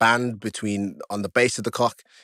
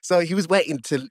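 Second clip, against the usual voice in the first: ordinary talk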